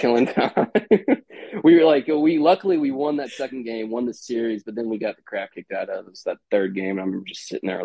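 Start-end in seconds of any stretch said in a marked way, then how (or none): 0.8-1.6 short high laugh; real amused chuckle, pitched up; brief burst of high laughter. Real, not acted. Laughs about killing time.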